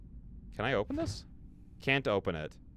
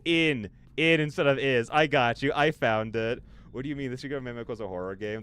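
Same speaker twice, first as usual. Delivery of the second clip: ordinary delivery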